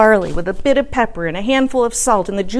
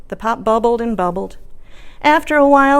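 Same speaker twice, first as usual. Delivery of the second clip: usual speaking voice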